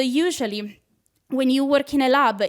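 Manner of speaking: ordinary talk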